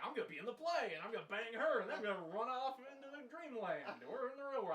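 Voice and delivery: lightly voiced scheming Puck; mock Puck voice. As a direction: play the mischief maker plotting. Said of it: "You're like".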